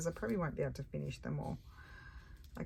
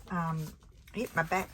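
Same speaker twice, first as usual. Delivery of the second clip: normal talking voice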